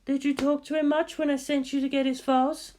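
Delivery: high, cool female voice; lightly voiced cool scientist asking; Ritsuko voice. Scientist asks Misato about Shinji, high female voice.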